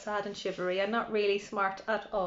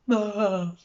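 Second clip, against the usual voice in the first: a short howling cry; dog howling by the bog; 3 dB louder than usual. A narrator's reading. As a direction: howl like a sad dog. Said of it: "who was howling".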